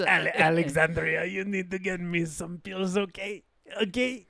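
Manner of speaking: mock Romanian accent, softening as it pleads; Adrian Tofay. Spoofing the actor asking Alexandria for pills, in a Romanian accent. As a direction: wheedle in a put-on Romanian accent. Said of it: "If it's Adrian Tofay".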